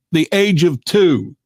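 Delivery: ordinary delivery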